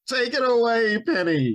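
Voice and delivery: playful mock host introducing his co-host; talk show host. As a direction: announce her like a morning show host. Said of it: "I thought we were doing a Regis and Kelly bit".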